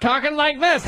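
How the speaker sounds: ordinary talk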